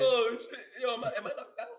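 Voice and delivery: loud and high, laughing through it; high laughing shout; laughing mock quote. Real, not acted. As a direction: cackle while quoting the dazed line. Friend mocking the knockout line.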